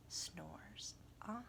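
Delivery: faint sleepy whisper. A narrator's reading. Narrator whispering the bear snores on. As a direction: let it fade to nothing.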